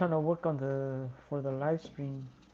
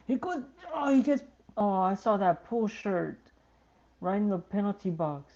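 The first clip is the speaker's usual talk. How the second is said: excited; 6 semitones higher, 4 dB louder than usual. Real, not acted. Near chance, suspected shirt pull.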